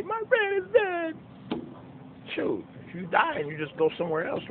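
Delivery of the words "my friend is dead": high, wailing mock sob; mocking mourners wail that their friend is dead; mock crying. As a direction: imitate a mourner crying, hammed-up. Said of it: "people cry and say".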